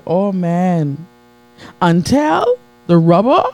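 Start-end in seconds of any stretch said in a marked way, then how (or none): none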